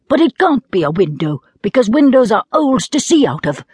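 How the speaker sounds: a hint of an earnest little boy. Diamond voiced as child.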